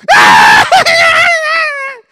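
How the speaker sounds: loud high mock wail; long, put-on crying shriek; pretend baby crying. Shown as a demonstration. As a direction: scream like a bawling baby. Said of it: "como si están llorando".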